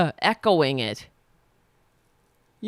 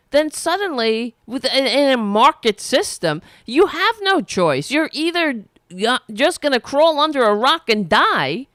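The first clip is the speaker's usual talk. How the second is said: fired-up rant; 6 semitones higher than usual. Real, not acted. Ranting to viewers about medical bankruptcy, indignant and raised. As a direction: rail against the system, voice pushed up high.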